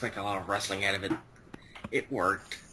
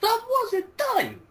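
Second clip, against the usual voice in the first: high, loud, drawn-out protest; shrill-pitched cry of interrupted outrage; indignant outburst; 5 dB louder, much slower than usual. Real, not acted. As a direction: object loudly at being cut off. Protesting being passed over mid-turn.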